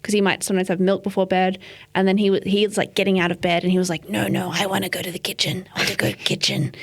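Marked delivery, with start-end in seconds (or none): 4.1-6.7 mock raspy toddler voice; raspy little nephew. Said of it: "That's how he talks".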